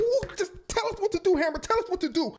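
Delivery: loud, very high-pitched clamour; itching for the order, hammed-up and frantic. Bodyguards begging Hammer for orders, wildly agitated. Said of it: "start getting way more agitated".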